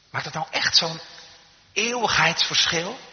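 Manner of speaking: high, rising doubtful question, slowing on the last word; incredulous question. Retold or imitated. Doubters asking if it makes an eternal difference, incredulous. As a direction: question it, stretching out "eeuwigheidsverschil". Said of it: "Soms zijn de mensen die zeggen".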